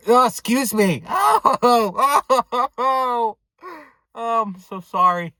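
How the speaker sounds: high, loud, hammed-up apology; drunk uncle impression. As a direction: imitate a tipsy man excusing himself loudly. Imitating drunk uncle burping, apologising.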